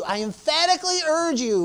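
high-pitched, emphatic appeal; emphatic urging. Shown as a demonstration. Preacher shows the congregation what urging means, emphatically. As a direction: urge it with a strong lift in pitch. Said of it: "what does emphatically urging look like".

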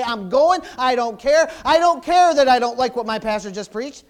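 loud, stubborn "I don't care"; determined believer shouting his resolve; defiant declaration. Pretend. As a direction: refuse to quit, out loud. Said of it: "You say".

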